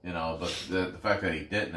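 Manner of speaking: ordinary talk, nothing marked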